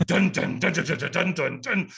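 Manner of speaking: rhythmic "dun dun" drum imitation; imitated drumming. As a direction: beat out the drums with your voice. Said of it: "Here's what I can hear".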